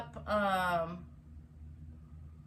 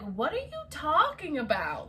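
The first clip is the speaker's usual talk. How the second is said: higher-pitched retold disbelief; indignant protest; 6 semitones higher than usual. She challenges the cop's charge, retold indignantly.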